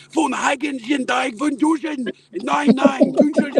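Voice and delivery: high, slow, aggressive German talk; barking German at high pitch. Retold or imitated. Germans chatting about soccer, aggressive German. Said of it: "they sound very aggressive".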